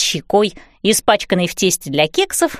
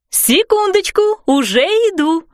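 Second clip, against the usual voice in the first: calling out; 10 semitones higher than usual. A narrator's reading. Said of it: "крикнула мама".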